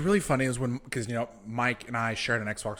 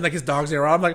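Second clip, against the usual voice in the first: ordinary talk, nothing marked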